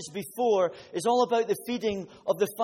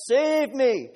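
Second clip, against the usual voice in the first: loud, higher cry for help; desperate cry; 7 semitones higher, 7 dB louder than usual. Acted. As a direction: cry out as you sink. Peter sinking cries out.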